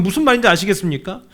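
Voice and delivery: normal talking voice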